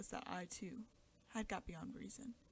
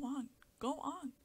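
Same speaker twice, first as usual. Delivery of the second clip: urgent father; 6 semitones higher, 7 dB louder, slower than usual. A narrator's reading. Father urging the doctor on.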